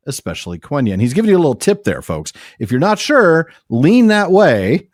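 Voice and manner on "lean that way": slow, higher, louder "lean that way"; emphatic tip. Real, not acted. Host urging listeners toward the antepenult, emphatic. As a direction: lean on each word, slowly. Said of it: "giving listeners a tip".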